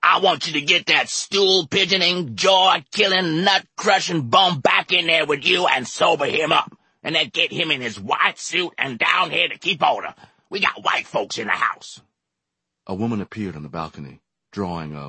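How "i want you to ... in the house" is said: high loud tirade up the stairs; furious yelled orders; angry yelling. A narrator's reading. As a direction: bark orders at the balcony. Bar owner yelling at woman.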